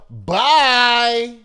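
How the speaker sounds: loud, high "bye"; loud sign-off. Real, not acted. Host signs off the Trump item, loud sign-off.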